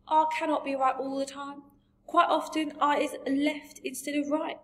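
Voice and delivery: lively storybook giant, relishing odd words; BFG. A narrator's reading.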